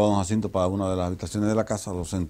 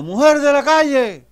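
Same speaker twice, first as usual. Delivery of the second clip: high, loud drawn-out call from the audience; shouted song request; 9 dB louder, slower than usual. Acted. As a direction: call out a request across a cabaret. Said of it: "dice uno ... peticiones". (petición gritada, aguda y alargada)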